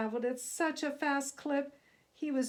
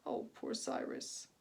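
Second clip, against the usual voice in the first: soft, breathed sympathy; pitying whisper; 2 semitones higher, 6 dB quieter than usual. A narrator's reading. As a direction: breathe it out in pity. Narrator pitying aside.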